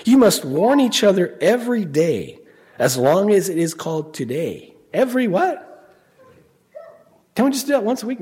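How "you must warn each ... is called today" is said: loud, raised, emphatic warning. Retold or imitated. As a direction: press each point home emphatically. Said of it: "he really emphatic because he says".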